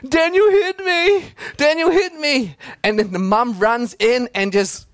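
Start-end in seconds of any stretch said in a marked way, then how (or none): 0.1-2.6 high, loud fake-sobbing little boy; mock crying toddler. Little brother runs crying to his mum, mock sobbing. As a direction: whine it like a toddler faking tears. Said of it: "he's crying and he's saying".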